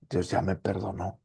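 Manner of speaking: normal talking voice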